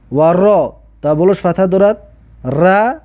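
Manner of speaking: ordinary talk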